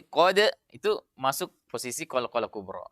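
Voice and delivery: ordinary talk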